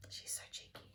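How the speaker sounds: breathy whispered aside. Real, not acted. Reader whispers a fond aside to her listeners.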